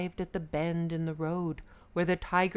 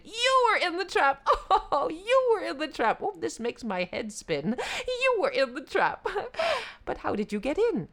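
high, loud laughing jackal; giddy shouting, mock confusion; excited shout; 12 semitones higher, 5 dB louder than usual. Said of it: "The jackal shouted".

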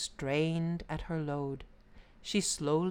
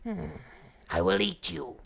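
snarling tiger; 2 semitones lower than usual. A narrator's reading. Freed tiger threatening to eat the Brahmin, snarling. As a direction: growl out a menacing threat. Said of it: "he snarled".

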